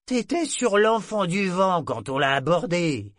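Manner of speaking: voix de marin plus haute et forte; vieux matelot animé. A narrator's reading. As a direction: raconte l'abordage avec entrain. Tarlock raconte le souvenir. (matelot éméché qui raconte le passé de Brachen)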